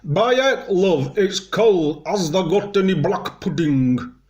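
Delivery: high, loud, slack-jowled Yorkshire; hammed-up Yorkshire pub customer; Yorkshire accent. Shown as a demonstration. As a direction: put on thick Yorkshire with loose jowls, pitched right up. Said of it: "loose jowls".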